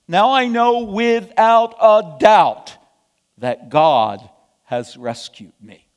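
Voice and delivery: slow, forceful declaration of certainty; emphatic exclamation. Acted. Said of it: "Peter exclaimed".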